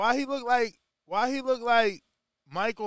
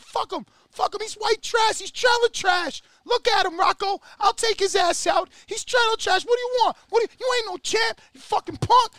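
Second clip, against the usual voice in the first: mock enraged Paulie, much higher and louder; hammed-up screaming taunt; Paulie shouting; 11 semitones higher, 5 dB louder than usual. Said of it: "he's like".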